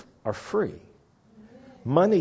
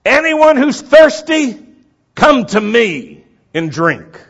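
high, slow shouted invitation; drawn-out open-air cry, easing at the end; loud proclamation; 11 semitones higher, 13 dB louder than usual. Acted. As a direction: call it out across a big crowd. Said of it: "He said things loudly".

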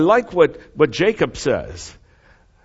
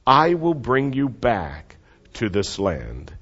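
slow, solemn promise; slower than usual. Shown as a demonstration. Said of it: "So say that with me".

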